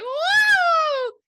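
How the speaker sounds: high, loud whoop of joy; excited whoop. Retold or imitated. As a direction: let out a thrilled, ringing woo. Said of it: "And so she's like".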